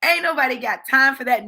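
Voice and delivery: ordinary delivery, nothing marked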